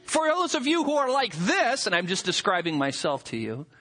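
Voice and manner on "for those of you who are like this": mock preacher voice. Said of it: "and then said".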